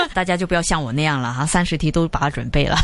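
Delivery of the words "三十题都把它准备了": ordinary delivery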